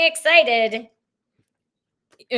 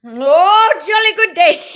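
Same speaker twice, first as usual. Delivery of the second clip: beam out as a cheerful cosmic guide; channeled Zach; 2 semitones higher than usual. Acted. Channeled spirit greeting the women, jolly and exuberant. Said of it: "welcome to Zach".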